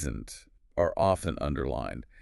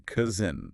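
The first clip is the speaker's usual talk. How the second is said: COUSIN with the weight on COUS; first-syllable stress. Shown as a demonstration. Teacher repeating cousin for learners, first part stressed. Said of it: "Stress on the first part again".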